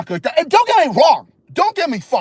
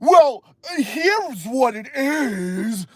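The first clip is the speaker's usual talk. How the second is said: very slow, high, drawn-out caricature; mock know-it-all expert; 5 semitones higher, much slower than usual. Streamer quoting the experts' favorite opener, slow mocking voice. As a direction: drag out each word as a mocked expert. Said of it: "they always gotta use the".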